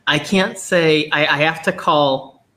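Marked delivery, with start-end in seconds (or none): none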